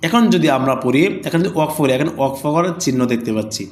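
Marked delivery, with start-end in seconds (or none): none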